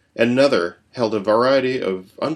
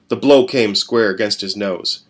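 usual speaking voice

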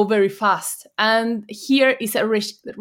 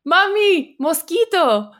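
put-on high, drawn-out child's call; imitated shout; 7 semitones higher, slower than usual. Daughter calling mom over a mosquito, imitated shout. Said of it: "she shouts to me".

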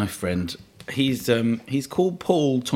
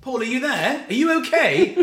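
calling out; 12 semitones higher than usual. Retold or imitated. Calling to Paul hidden in the woods, worried and high. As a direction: call anxiously toward the trees.

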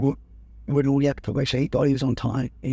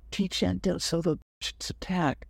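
high, hushed mock yell; imitated yelling; 5 semitones higher, 4 dB quieter than usual. Colonel yelling after the lieutenant is pulled out, mock yell. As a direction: yell it under your breath, pitched up, without the volume. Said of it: "Good Lake's yelling".

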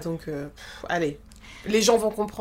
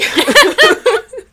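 high laugh; 14 semitones higher, 10 dB louder than usual. Real, not acted. Co-host laughing hard.

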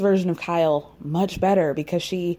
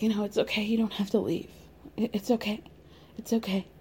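soft reassuring; 8 dB quieter, faster than usual. Retold or imitated. Erica soothing Garcelle, quoted.

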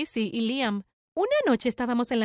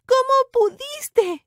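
drawn-out, high, loud cry of betrayal; anguished shout; 11 semitones higher, 6 dB louder, much slower than usual. A narrator's reading. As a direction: shout it slowly, accusing. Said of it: "ella me gritó". (grito dolido de reproche, agudo y alargado)

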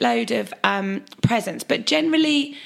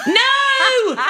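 high, loud panicked shout; 6 dB louder than usual. Retold or imitated. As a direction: yell it in a panic. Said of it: "shouting at his wife".

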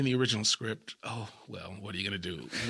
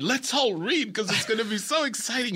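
high, bright mock enthusiasm; fake-excited producers; 8 semitones higher than usual. Producers urge the cast to read, mock excitement. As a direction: gush about the new script. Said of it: "They said".

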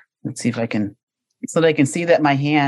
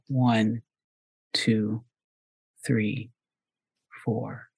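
guided breathing count; slower than usual. Shown as a demonstration. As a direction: count the breaths calmly, letting it fade. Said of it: "leads a counted breath".

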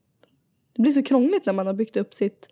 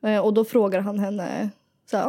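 usual speaking voice

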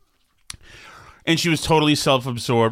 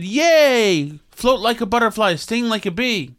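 high-pitched gushing mock cheer; mock excitement; 8 semitones higher, slower than usual. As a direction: cheer it, imitating an overeager tweeter. Mocking retelling of woman's tweet.

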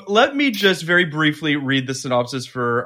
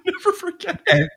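laughing echo. Real, not acted. Laughing echo of friend's line.

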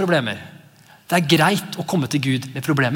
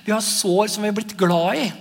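usual speaking voice

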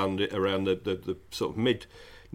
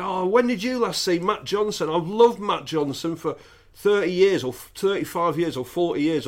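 put-on high, louder gushing drunk fan; drunk superfan; 7 semitones higher, 3 dB louder than usual. Telling the story of a drunk fan at the gig, high and gushing. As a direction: gush like a refreshed superfan, pitched up. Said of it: "I'm not gonna do the accent".

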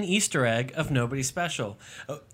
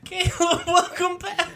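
mock announcer greeting; slower than usual. Mock greeting to returning cohost.

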